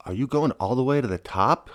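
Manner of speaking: lightly voiced puzzlement; puzzled. A narrator's reading. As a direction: ask it as a puzzled woman hiker. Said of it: "asked with a puzzled look".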